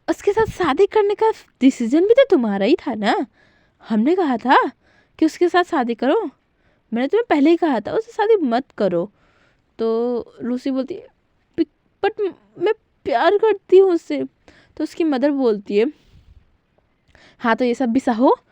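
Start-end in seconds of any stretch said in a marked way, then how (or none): 0.1-9.1 higher, louder scolding through gritted teeth. Acted. Mother scolds Lucy on phone, through gritted teeth. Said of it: "थोड़ा सा दांत पिसते हुए". 11.6-14.2 pleading daughter. Acted. Lucy pleads with mother.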